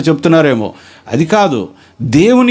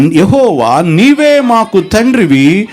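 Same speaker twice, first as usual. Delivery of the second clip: solemn scripture reading; 2 semitones higher, much slower than usual. Acted. Preacher reading Isaiah to the congregation, slow and solemn. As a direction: lean into every word like a pulpit lament. Reads verse eight in preaching.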